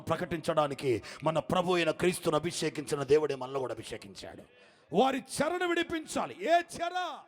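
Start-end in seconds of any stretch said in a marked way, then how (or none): 5.0-7.2 high, shouted sermon build; fiery preaching. Acted. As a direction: thunder it out to the congregation. Preaching at full force.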